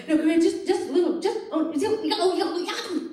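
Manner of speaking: high, breathy mock moans; mock moaning directions. To the sound tech, a hammed-up lover's moan. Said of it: "That's how I direct my lovers".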